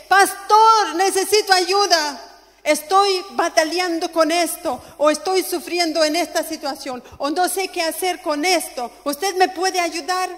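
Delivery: loud, high, urgent plea; crying out for help. Acted. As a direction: call out to the pastor, pleading at full voice. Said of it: "usted a veces no grita, no dice".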